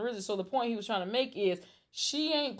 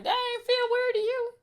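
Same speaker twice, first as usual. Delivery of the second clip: high-pitched disbelief; incredulous; 14 semitones higher than usual. Retold or imitated. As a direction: ask it in shocked disbelief. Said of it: "and I'm like".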